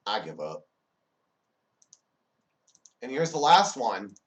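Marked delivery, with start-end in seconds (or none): 0.1-0.7 low, soft surrender; defeated mumble after the outbursts; resigned. Acted. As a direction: drop the voice and give up. Texter surrenders after failed corrections.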